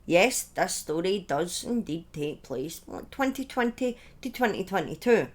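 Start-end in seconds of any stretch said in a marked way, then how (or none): none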